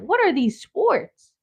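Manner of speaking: high, slow puzzled question; mock confused kid; baffled. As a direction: drag it out in disbelief. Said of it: "I'm like".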